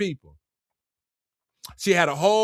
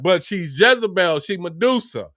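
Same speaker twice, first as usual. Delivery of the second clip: normal talking voice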